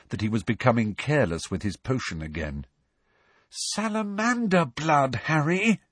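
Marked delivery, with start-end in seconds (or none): 3.5-5.8 high, slow exasperated moan. Acted. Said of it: "Hermione moaned".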